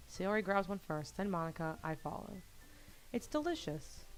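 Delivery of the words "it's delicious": high-pitched delighted mumble through food; much higher girlish voice, as if chewing; mouth full. Acted. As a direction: talk happily through a mouthful. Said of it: "Sayori talks with her mouth full".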